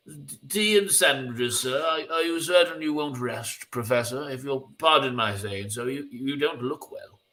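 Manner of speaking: polite, solicitous butler voice, pitch swelling gently; concerned butler. Acted. Butler urging the professor to rest, concerned. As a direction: fuss hesitantly over an unwell employer.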